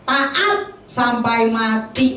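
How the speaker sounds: "Taat sampai mati" very slow, word by word; solemn emphasis. Real, not acted. Preacher drives home Jesus's obedience, "Taat sampai mati" slow and weighty. Repeats key phrase for emphasis.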